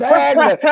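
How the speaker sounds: "Wagner" blurted high and loud as "Vagner"; mispronounced Wagner. Retold or imitated. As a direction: mimic him botching "Wagner", high and loud. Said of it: "it kept coming out Wagner".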